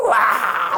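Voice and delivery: long, high bursting yell; drawn-out mock holler; imitated prayer shout. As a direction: let a pent-up cry explode. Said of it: "소리 지르는 거예요".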